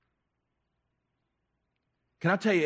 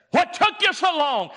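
high, loud call of a waiting woman; a woman shouting at late visitors; Rahab calling out; 13 semitones higher, 6 dB louder than usual. Acted. Preacher voicing Rahab, loud high call. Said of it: "what did she say?".

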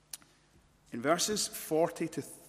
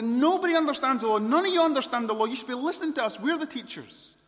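high, fast, shouted tirade; furious Pharisees; 13 semitones higher, 11 dB louder, faster than usual. Acted. Pharisees lecture the crowd as the teachers, furious shouting. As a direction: rant at full voice, pulling rank. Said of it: "the fury here of the".

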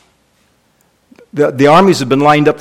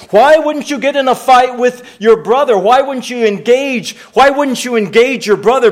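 emphatic preaching; 6 semitones higher than usual. Real, not acted. Preacher presses the congregation.

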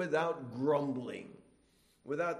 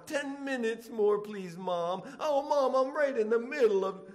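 put-on much higher, louder childish begging; child pleading whine; 8 semitones higher, 6 dB louder than usual. Child begging Mom for more playtime, much higher whining. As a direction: beg Mom like a kid stalling. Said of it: "And they say".

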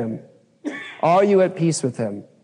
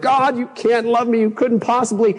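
higher, hammed-up reproach to God; mock pleading complaint; 7 semitones higher than usual. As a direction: shake your fist at God in a mock whine. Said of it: "I'm like".

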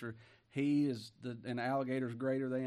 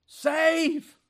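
much higher, much louder plea; desperate cry; 12 dB louder than usual. Acted. A lost soul crying to the Lord, desperate. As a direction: cry out for rescue.

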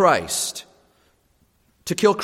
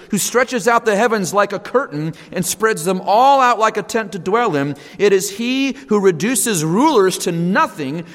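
raised, ringing scripture reading; preaching at full force; 4 semitones higher than usual. Acted. Preacher reading Isaiah to his congregation, in full ringing proclamation. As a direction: proclaim God's greatness over the nations.

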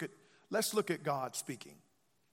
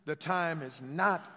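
slow, loud "The time is not", landing hard on "not"; drawn-out call with a heavy "not"; stress on NOT; 5 dB louder, slower than usual. Shown as a demonstration. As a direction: hit "not" for the congregation to echo. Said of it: "Emphasize not".

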